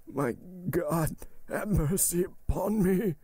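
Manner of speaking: high, drawn-out dying plea; anguished plea. A narrator's reading. Dying servant begs God for mercy, high and agonized. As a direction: stretch the words in a desperate prayer.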